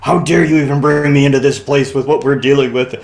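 hammed-up fury at his wife; indignant.

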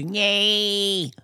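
high, drawn-out Scouse whine; Scouse accent. Acted. Doing a Steve Gerrard kind of Scouser, high-pitched. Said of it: "a certain kind of Scouser".

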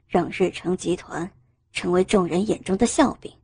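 low, soft, icy male voice; restrained male coldness, pitched well down; cold. A narrator's reading. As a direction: deliver a chilly reprimand in a deep register. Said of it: "陆雨谦冷冷说道".

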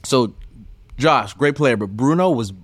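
ordinary delivery

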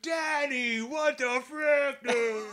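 high, loud mock outrage; mocking classmates. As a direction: squeal it like indignant kids teasing. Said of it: "And everybody was like".

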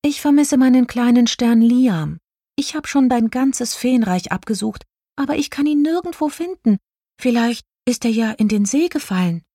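high-pitched fairy voice, missing someone; a small high voice searching for a lost friend; little star fairy, worried. A narrator's reading. Fairy misses her little star.

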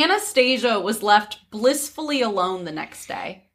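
ordinary delivery

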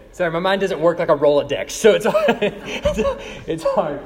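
sheepish laughing excuse; laughing apology. Real, not acted. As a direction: laugh it off, embarrassed. Embarrassed about forgetting names.

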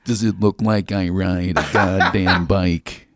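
slow, low Nicholson growl; low slow sneering Nicholson voice; Jack Nicholson impression. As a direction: drawl it out like a grumpy Nicholson. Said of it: "he goes".

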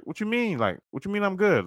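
high, challenging "what you mean"; mock confrontational face. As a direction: push back like you're sizing him up, not aggressive. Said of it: "I put on that face".

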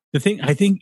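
ordinary talk, nothing marked

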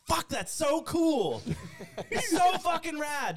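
high, drawn-out gushing cry; gushing awe. Retold or imitated. Host retelling his reaction to Jake's texts, gushing. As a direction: stretch it out high in mock-awed delight.

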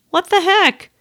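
high, put-out exclamation; incredulous what-the-heck; exasperated. Retold or imitated. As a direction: throw up your hands at the airline. Exasperated at missing meal voucher.

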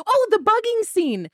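light up at the memory; excited recall. Real, not acted. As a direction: fan remembering a favourite scene, delighted.

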